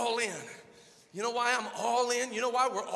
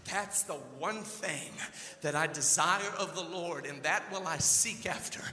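ordinary delivery

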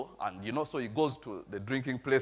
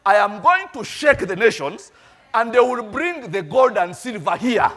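loud, climbing preacher's cry, higher and higher; preaching at full force; 7 semitones higher, 11 dB louder than usual. Acted. Pastor rallying discouraged listeners, loud fervent preaching. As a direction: rouse the congregation with God's promise.

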